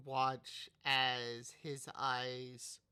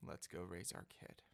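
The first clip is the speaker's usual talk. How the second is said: quiet, low tender line; lower, softer villain voice; 6 semitones lower, 9 dB quieter than usual. Acted. Hades softly telling Persephone to go raise their child, low. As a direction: say it low and gentle, much softer. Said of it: "he looks at her and goes".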